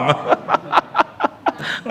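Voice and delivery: airy giggle up high; amused preacher's giggle; high breathy laugh. Real, not acted. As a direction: laugh lightly at your own joke. Laughs at own mock prayer.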